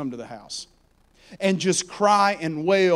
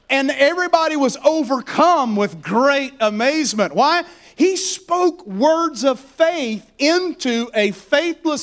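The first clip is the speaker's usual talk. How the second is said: raised, driving preacher's voice building in pitch; impassioned preaching; 7 semitones higher, 3 dB louder than usual. Real, not acted. Preacher urging the congregation into faith, impassioned preaching. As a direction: preach it with relentless rising urgency.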